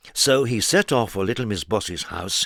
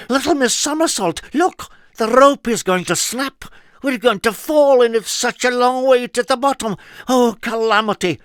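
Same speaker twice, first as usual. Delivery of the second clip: high, loud panicked cries; 13 semitones higher, 7 dB louder than usual. A narrator's reading. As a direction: shriek in comic panic. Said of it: "he cried out in panic".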